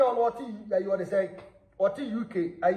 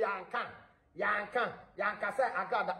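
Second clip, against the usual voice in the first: dropped right down to a hush; near-silent conspiratorial aside; 10 dB quieter than usual. Real, not acted. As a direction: say it under your breath. Hushed aside after exclamation.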